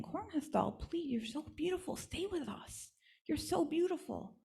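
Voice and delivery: put-on high, hushed childlike begging; softly pleading children. Village children softly begging the doll to stay, high.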